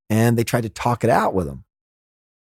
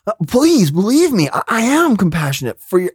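higher-pitched imitation of a pleading son; earnest plea; 6 semitones higher than usual. Boy's acted plea to parents.

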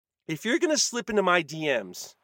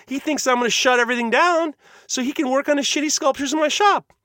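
getting mad; 8 semitones higher than usual. Real, not acted. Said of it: "I'm getting mad".

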